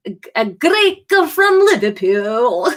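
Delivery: slow, laughing Scouse-Greek put-on accent; Liverpudlian Greek accent. Streamer attempts a Liverpudlian Greek voice, laughing. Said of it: "Liverpudlian Greek".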